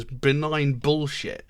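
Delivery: ordinary talk, nothing marked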